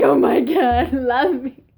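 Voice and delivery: playful fake sob; mock crying. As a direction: retell a tearful plea, overdramatically. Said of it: "like, crying".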